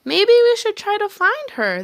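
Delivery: put-on high, loud child voice; kids' voices. A narrator's reading. Kids missing their teacher, high child voice. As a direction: sound like worried children. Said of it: "they said".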